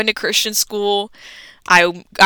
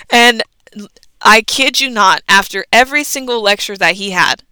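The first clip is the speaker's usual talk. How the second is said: ordinary talk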